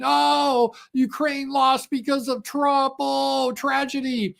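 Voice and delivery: high, drawn-out mock wailing; mock media lament. Host mimicking media carrying on over Ukraine, mock lament. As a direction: carry on as if it were a tragedy. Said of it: "the media would carry on".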